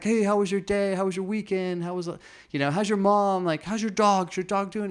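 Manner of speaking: high, lively string of questions; friendly chatty boss. Retold or imitated. Zach chatting up his staff, high and upbeat. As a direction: gush with interest in each person.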